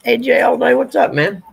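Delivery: loud, high, cheerful hello; excited greeting. Real, not acted. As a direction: call out a big hello. Greeting viewer enthusiastically.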